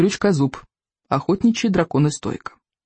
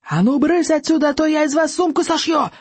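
much higher furious yell; bellowing chief; 11 semitones higher than usual. Acted. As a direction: scream the dragons out of the room. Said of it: "завопил Стоик".

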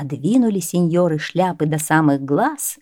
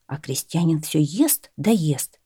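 plain reading voice

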